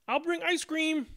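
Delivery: a hammed-up high cry of delight; excited squeal. A narrator's reading. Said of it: "I screamed".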